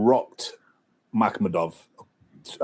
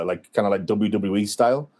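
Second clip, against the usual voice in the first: ordinary talk, nothing marked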